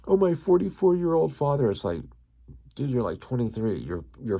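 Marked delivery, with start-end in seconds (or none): 0.1-1.7 higher, louder, mocking sob-story voice; mock whiny plea. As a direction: parody someone guilt-tripping. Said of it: "use all this emotional blackmail".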